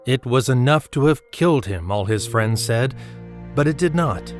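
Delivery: ordinary talk, nothing marked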